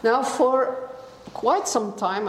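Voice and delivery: ordinary delivery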